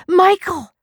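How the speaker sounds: anguished falling scream. A narrator's reading. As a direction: wail the name as hope breaks. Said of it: "She dropped it and screamed".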